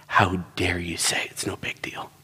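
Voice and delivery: low, hushed mock outrage; retold anger in a near-whisper; quietly livid. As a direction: imitate a wife seething under the breath. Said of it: "was livid with me".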